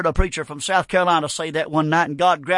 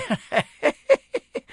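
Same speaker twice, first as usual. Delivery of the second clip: laugh; 8 semitones higher, 3 dB quieter than usual. Real, not acted. Preacher laughing after shouting.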